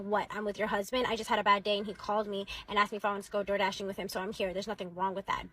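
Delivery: ordinary delivery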